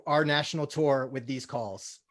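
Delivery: ordinary talk, nothing marked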